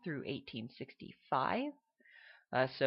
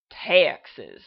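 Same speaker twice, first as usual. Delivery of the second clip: loud, hammed-up Texan "Texas"; over-the-top Texas drawl; obnoxious Texas accent; 13 dB louder than usual. Shown as a demonstration. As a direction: bellow "Texas" like a proud Texan. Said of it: "with the most obnoxious Texas accent".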